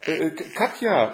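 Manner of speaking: high, startled stutter; sleepy stammer. A narrator's reading. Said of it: "stammelte sie noch etwas schlaftrunken".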